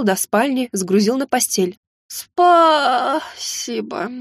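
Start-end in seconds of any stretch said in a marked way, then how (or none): 2.1-4.1 drawn out through a big yawn; sleepy thanks yawned out after being carried to bed. A narrator's reading. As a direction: say it mid-yawn, very slow. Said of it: "неожиданно зевнула я".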